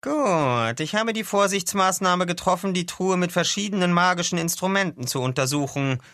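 high-pitched, formal doctor voice; Doctor Skamandros, explaining. Acted. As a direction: explain your precautions like a careful expert.

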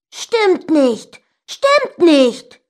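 high, loud stubborn denial; shouted quarrel. A narrator's reading. Brother contradicts sister in quarrel, high shouting. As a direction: shout it twice, refusing to give in.

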